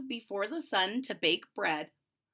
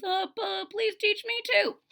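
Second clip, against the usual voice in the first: much higher pleading; eager Big Anthony; 10 semitones higher than usual. Acted. Big Anthony asking the witch, high pleading. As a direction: whine to be taught.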